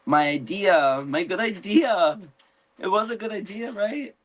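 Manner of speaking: rising high whiny voice; Rhaenyra fishing for approval. Retold or imitated. Rhaenyra whining to Jacaerys, high and pleading, that her idea was good.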